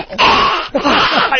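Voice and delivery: high burst of laughter; real laugh. Real, not acted. Teller to talk show host, bursting into laughter. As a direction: crack up mid-story.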